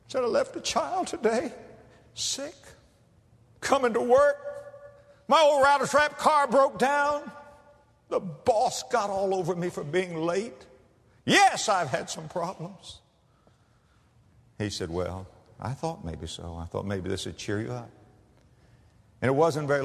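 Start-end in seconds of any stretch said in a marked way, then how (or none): 0.2-13.0 tearful waitress. Retold or imitated. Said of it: "She began to cry". 14.9-17.9 soft, low, kind words; gentle comfort. Retold or imitated. Preacher gently comforts the crying waitress, softly.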